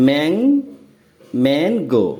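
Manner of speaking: "Mang-" alone, then mango in two parts; first syllable "mang" held up alone, then "mang-go"; broken into syllables. Shown as a demonstration. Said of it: "বন্ধনীতে দেখো".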